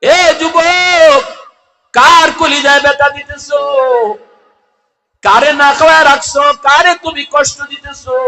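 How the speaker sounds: high, loud sermon cry; full-force preaching. Real, not acted. (উচ্চস্বরে জোরালো ওয়াজ)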